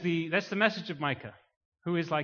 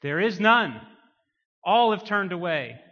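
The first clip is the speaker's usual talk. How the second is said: loud, raised preacher's declaration; forceful proclamation; 4 semitones higher, 9 dB louder than usual. Real, not acted. Preacher declaring that all have turned away, full-force preaching. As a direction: hammer it out to the congregation.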